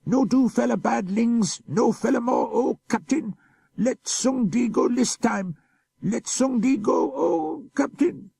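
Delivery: pleading for mercy. Acted. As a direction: beg for your life in broken pidgin, terrified. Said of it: "He cried to the captain in his pidgin for mercy".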